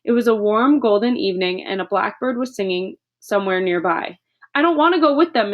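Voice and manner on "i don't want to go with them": high, loud outburst of refusal; blurting out defiance; sudden shout. Acted. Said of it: "Matilda shouted suddenly".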